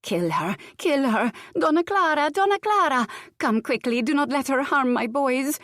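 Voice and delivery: high, loud panicked calls for help; frantic screaming. A narrator's reading. Countess calling for Donna Clara while screaming. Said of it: "Luciana screamed".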